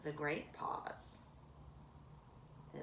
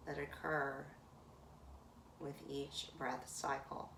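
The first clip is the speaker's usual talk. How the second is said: guided meditation. Acted. Yoga teacher guiding her class to notice the breath, calm meditation voice. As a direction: let each phrase settle before the next.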